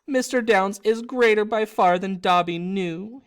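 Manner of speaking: sobbed. Acted. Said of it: "he sobbed".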